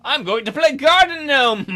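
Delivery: ordinary talk, nothing marked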